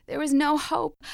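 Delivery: ordinary delivery, nothing marked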